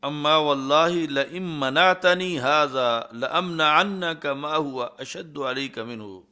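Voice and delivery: slow, measured chant of the Arabic; slow recitation. Acted. Preacher reciting Saad's reply in Arabic, slow chant. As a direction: intone each word slowly.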